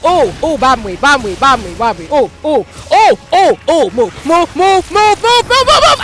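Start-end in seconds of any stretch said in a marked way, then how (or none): 2.1-6.0 shout. Real, not acted. Urging in fight.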